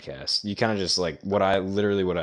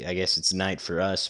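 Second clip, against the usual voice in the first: usual speaking voice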